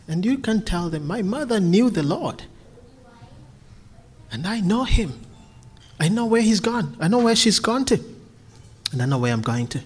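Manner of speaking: usual speaking voice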